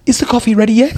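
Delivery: mock the customer nagging for coffee; impatient customer. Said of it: "when they're like".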